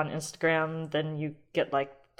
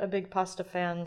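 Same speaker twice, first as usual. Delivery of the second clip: ordinary talk, nothing marked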